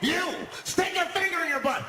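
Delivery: high, loud bossy demand; demanding homeless guy. Acted. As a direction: order it like a deranged bus tyrant. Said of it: "he starts making demands".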